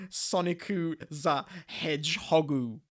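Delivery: slow mock Japanese accent reading. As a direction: sound it out in a put-on Japanese accent. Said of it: "in a Japanese accent".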